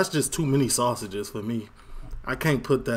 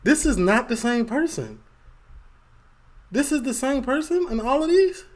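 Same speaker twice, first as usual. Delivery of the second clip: surprised; 8 semitones higher, 3 dB louder than usual. Real, not acted. Reacting to a before and after.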